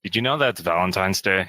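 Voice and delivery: dour, bored read. Shown as a demonstration. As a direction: read it like you're bored of yourself. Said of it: "Yeah, that's you. Just be yourself".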